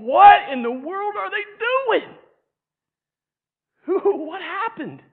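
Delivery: stunned witness gasping out questions; amazed onlooker. Acted. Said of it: "I could see it".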